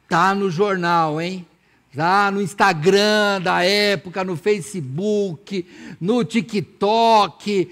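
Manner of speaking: very slow, stretched-out playful list; drawn-out proclamation. Acted. Preacher jokes news of blind man was everywhere, proclaiming. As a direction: draw out each place like spreading news.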